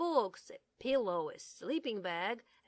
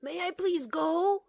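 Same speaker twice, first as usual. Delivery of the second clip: high, loud, hopeful begging; eager pleading; 9 semitones higher, 9 dB louder than usual. Acted. Little bear begging his parents for the sleepover, eagerly. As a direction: ask like a kid desperate for a yes.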